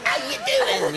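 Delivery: high, loud fake Cockney accent; bad Mary Poppins Cockney. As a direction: overdo a stagey Cockney greeting. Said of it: "Mary Poppins kind of".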